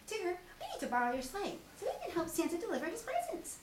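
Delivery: high, fast little pig; hurried squeaky request; Piglet voice. Acted. Piglet asking Tigger for the sleigh, fast and high.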